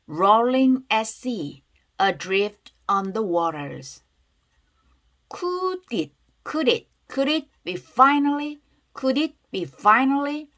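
slow and deliberate, words drawn out; read-aloud pronunciation demo. Shown as a demonstration. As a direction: model each phrase slowly for repeating. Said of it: "큰 소리로 따라해볼게요". (천천히 또박또박 늘여 읽는 발음 시범)

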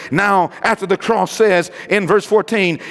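ordinary delivery

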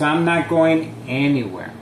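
ordinary talk, nothing marked

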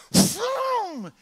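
loud, high vroom of a plane; plane engine vroom. Retold or imitated. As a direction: make the roar of the plane. Imitating a plane.

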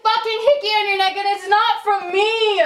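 high-pitched, upset outburst; hurt, angry accusation. Real, not acted. Real quarrel over a hickey.

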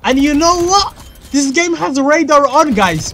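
high, loud outburst; almost screaming. Real, not acted. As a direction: yell it just short of a scream. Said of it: "I almost screamed".

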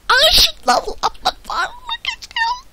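very high, squeaky exclamation. Pretend. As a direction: squeal it like a cartoon.

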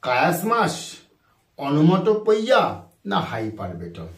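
usual speaking voice

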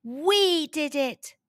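slow, drawn-out cheer; triumphant cry. A narrator's reading. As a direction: cry it out gleefully, lightly. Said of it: "cried Noah".

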